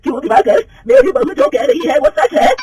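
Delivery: mother-in-law confronts the manager in anger; angry demand. Acted. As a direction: confront the cheat furiously. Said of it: "गुस्से से दिवाकर को पूछती है".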